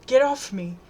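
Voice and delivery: sharp protest. A narrator's reading. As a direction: push him off with your voice. Girl fighting off attacker.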